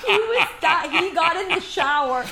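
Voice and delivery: real laughter breaking through, high and loud; laughing. Real, not acted. Retelling the father-in-law's shower mishap, laughing. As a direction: crack up while telling it. Cracks up telling the salsa story.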